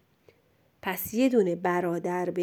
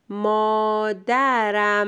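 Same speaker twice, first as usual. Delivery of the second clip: مادرم stretched syllable by syllable; slow dictation; much slower than usual. Shown as a demonstration. Teacher dictates for a pupil writing, very drawn-out. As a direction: hold each vowel and the final m of مادرم.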